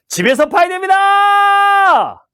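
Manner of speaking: very high, slow, loud shout; confident shout. Shown as a demonstration. 지하철 승객들에게 자신 있게 외치는 큰 소리. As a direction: belt it out to the whole car. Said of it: "자신 있게 외칩니다".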